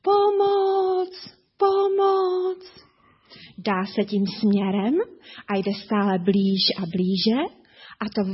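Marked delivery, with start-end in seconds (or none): 0.0-2.8 high, distant call for help from far off; a tiger's faint cries of help from the distance; faint cry for help. A narrator's reading. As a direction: call out as if heard from far away. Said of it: "slabounké volání".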